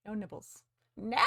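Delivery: usual speaking voice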